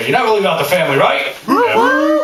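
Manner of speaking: rising rallying call; rallying cry. Real, not acted. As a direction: rouse the crowd. Rallying the juggalos.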